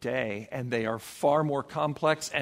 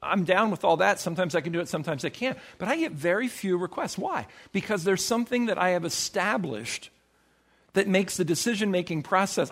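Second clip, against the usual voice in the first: normal talking voice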